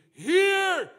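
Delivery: very high, loud, drawn-out shout; thunderous prophetic command; bold proclamation. Shown as a demonstration. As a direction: cry it out boldly, stretching each word. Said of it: "boldness and say to them".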